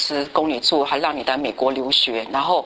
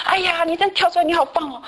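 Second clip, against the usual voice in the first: much higher, lively excited praise; 12 semitones higher than usual. Retold or imitated. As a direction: gush over the friend, pitched up. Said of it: "就很兴奋说". (兴奋地高声称赞)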